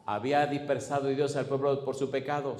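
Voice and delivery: ordinary talk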